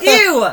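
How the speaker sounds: high, very loud "ew"; disgusted ew. Real, not acted. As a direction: squeal in disgust at a friend. Disgusted by friend's toilet remark.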